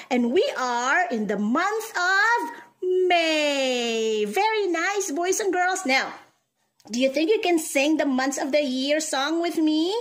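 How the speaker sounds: ordinary talk, nothing marked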